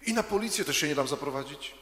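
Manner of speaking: put-on a child's sharp, defiant refusal. A narrator's reading. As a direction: snap it back like a stubborn child. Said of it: "tak ostro".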